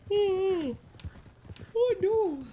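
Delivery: startled yelp of real alarm. Real, not acted. Sudden in-game alarm.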